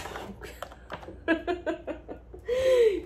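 high, breathy burst of laughter; overwhelmed high laugh. Real, not acted. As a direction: laugh rising into a squeal. Laughs after promising payback.

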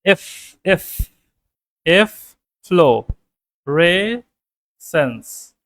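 "এফ, flow, রে, sense" broken into separate syllables with pauses. Shown as a demonstration. Teacher breaking the word into syllables for the class. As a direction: spell out "এফ্লোরেসেন্স" in slow chunks. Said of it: "এই যে দেখেন".

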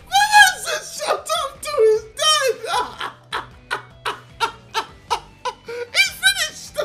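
high, wheezing cry-laugh. Real, not acted. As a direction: squeal through tears of laughter. Losing it laughing at the clip.

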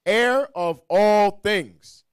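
ordinary talk, nothing marked

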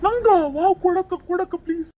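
high, eager pleading; excited begging. Acted. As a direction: beg big sister excitedly for the phone. Brother begs sister for the phone.

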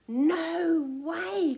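indignant outburst, high and loud; cried refusal. A narrator's reading. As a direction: cry out a firm refusal. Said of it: "cried T".

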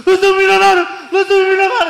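high, loud hysterical joy; mock tearful shouting. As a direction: wail it like an overwhelmed new millionaire. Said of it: "ofegante, chorando".

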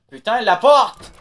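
loud, high, slow panicked yell; panicking gamer. Real, not acted. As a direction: scream at the door in panic. Monster chasing, panicked yell.

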